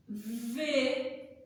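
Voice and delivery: usual speaking voice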